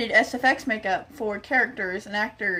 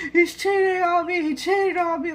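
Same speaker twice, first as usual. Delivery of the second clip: higher, louder tearful wail; imitating crying; 7 semitones higher, 4 dB louder than usual. The brunette crying about her cheating boyfriend, imitated tearful wail. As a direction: play her breaking down. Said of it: "starts crying, like, full on tears".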